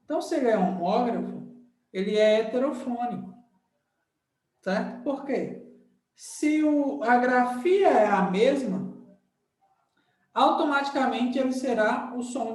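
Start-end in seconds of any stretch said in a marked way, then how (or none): none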